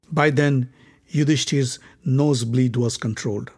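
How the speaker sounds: usual speaking voice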